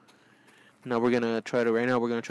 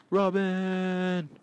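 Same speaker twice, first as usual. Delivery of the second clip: high calling cry for Robin; high distant call; 7 semitones higher than usual. Acted.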